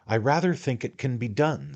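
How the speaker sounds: a hint of a fussy little attorney; little attorney, confiding. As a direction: fuss over him, dear sir. The attorney talks in the story.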